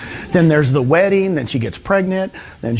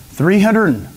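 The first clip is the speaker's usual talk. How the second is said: normal talking voice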